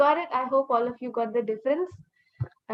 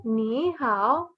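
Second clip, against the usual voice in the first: "Ni hao" drawn out, hao dipping down and up; third tone. Shown as a demonstration. Teacher points out her tone, dipping "Ni hao". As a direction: lean into the dip of "Ni hao". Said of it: "There's a third tone".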